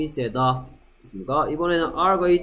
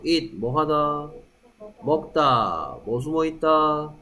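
ordinary talk

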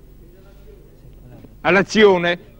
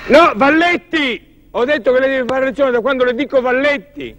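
scolding; 7 semitones higher than usual. Real, not acted. Director scolding a cue mistake.

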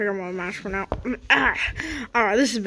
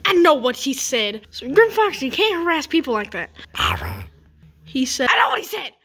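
annoyed scolding; 3 semitones higher than usual. Acted.

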